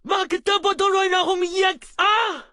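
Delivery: stern, shouted scolding; harsh telling-off at full voice; angry scolding. Acted. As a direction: scold forcefully, hammering out each order.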